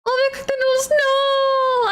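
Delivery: high, tearful cry of dismay. Real, not acted. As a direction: wail it through tears. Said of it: "I'm a little bit of a crybaby".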